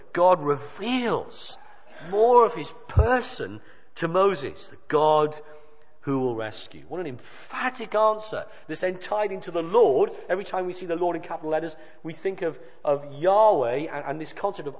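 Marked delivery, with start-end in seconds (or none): none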